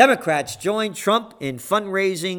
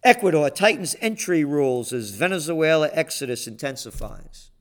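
normal talking voice

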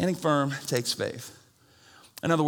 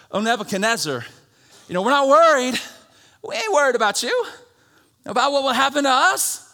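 higher, louder casual defiance; defiant young men; 9 semitones higher, 7 dB louder than usual. Retold or imitated. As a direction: brush off the king. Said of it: "they replied".

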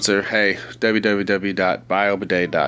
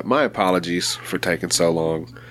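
ordinary talk

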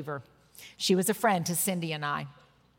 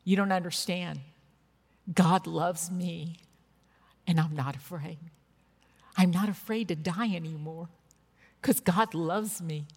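voicing a dying friend's tender conviction; earnest assurance; 4 semitones lower than usual. Dying woman's earnest correction retold.

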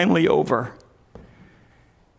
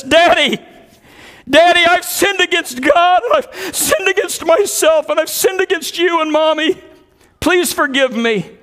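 high, crying confession to her father; tearful plea; 12 semitones higher, 4 dB louder than usual. Retold or imitated. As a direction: beg forgiveness through tears. Daughter weeping, confessing to father.

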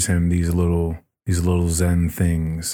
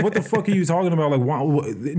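much higher and fast, incredulous; baffled pilot; 7 semitones higher, faster than usual. Retold or imitated. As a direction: sputter it out in disbelief. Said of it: "but he's like".

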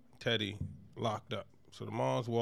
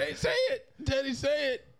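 much higher, desperate shouting; desperate yell; 14 semitones higher, 4 dB louder than usual. Retold or imitated. Host as Franklin yelling at the dying Teddy, desperate. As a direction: scream at him to say it.

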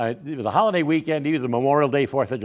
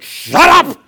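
very high, full-force scream; acted enraged shriek, far louder than talk; furious screaming; 13 dB louder, much slower than usual. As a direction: scream right into someone's face. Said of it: "got right in my face".

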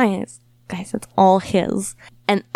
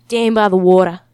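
loud bad Aussie accent; a hammy Australian twang; 2 semitones lower, 6 dB louder than usual. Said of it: "You have a really bad Australian accent".

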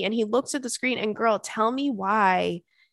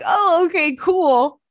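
mock cheerful okay, higher and louder; mock cheery; 8 semitones higher, 7 dB louder, slower than usual. Patient told to wait months, fake bright okay. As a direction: fake chipper acceptance, pitched up.

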